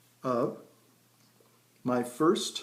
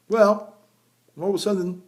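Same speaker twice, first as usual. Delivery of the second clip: ordinary talk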